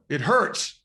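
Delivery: a sudden intense It hurts, pushed out. Retold or imitated. Wendy telling a visitor It hurts, with intensity. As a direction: hit It hurts with raw intensity. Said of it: "with this intensity".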